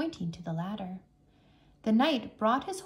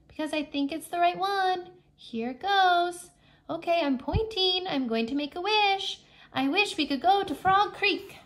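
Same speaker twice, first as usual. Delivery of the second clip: put-on high, eager little-girl voice; bright and hopeful as she makes the wish; young girl Annie; 8 semitones higher, 3 dB louder than usual. A narrator's reading.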